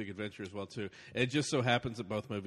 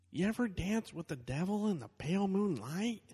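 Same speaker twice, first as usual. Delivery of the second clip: Owen Wilson voice; 8 semitones higher than usual. Pretend. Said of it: "here's Owen Wilson, again".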